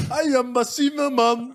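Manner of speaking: high-pitched cartoon mascot catchphrase; Cinnamon Toast Crunch cartoon. Quoting a cereal ad's famous line to friends, cartoon mascot voice. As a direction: squeal it like a cereal-ad character. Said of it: "what was the famous line from Cinnamon Toast".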